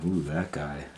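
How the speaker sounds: ordinary delivery, nothing marked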